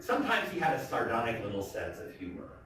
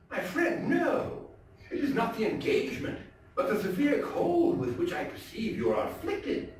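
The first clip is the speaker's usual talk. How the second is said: mock concerned host; 14 semitones higher than usual. Montresor feigns concern.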